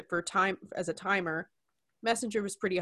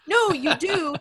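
emphatic retort; 8 semitones higher, 7 dB louder, slower than usual. Acted. Retorts to imagined complaint.

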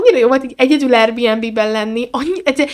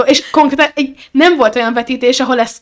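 ordinary talk

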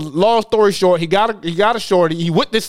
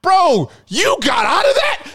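much higher, slow, stunned question; amazed disbelief; 10 semitones higher, slower than usual. Retold or imitated. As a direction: drag it out in wide-eyed shock. Amazed he escaped trouble.

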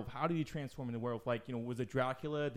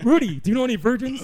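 much higher and louder blurt; eager kid; 10 semitones higher, 14 dB louder than usual. Retold or imitated. Sean asking Rudy about virgins, in a high loud blurt. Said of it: "right when he asks".

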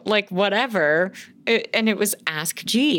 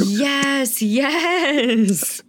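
excited yes; 9 semitones higher, 3 dB louder than usual. Real, not acted. Excited about Futurama.

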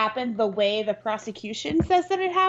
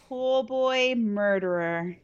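slow, savoring; much slower than usual. Real, not acted. Savoring the movie title.

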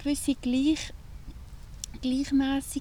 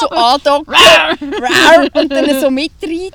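louder, laughing through a mock growl; laughing, playful growl; 5 semitones higher, 19 dB louder than usual. Real, not acted. Laughing as she acts out the beast latching on. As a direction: growl it while cracking up.

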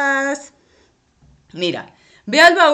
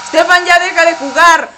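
loud, raised and fast reprimand; loud "stop playing" to a distracted kid; stern scolding; 6 semitones higher, 8 dB louder, faster than usual. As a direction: snap him out of playing.

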